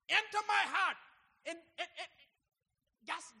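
loud, high, defiant preaching; preaching at full force. Acted. Preacher daring the doubters in the congregation, loud and defiant. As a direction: hurl the challenge at doubters.

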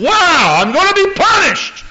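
put-on high, loud frightened kid's cry; scared child voice. Preacher voicing a child who fears the belt, high and scared. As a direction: squeal it like a terrified little boy. Child's fearful thought of punishment.

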